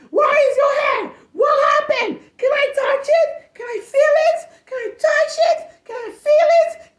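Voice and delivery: put-on very high, loud squealing kid voices; excited kids. Teacher mimics her excited students squealing over her wig. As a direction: squeal like a crowd of excited children.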